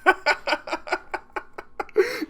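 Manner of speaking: high-pitched burst of laughter. Real, not acted. Laughs at comic duo.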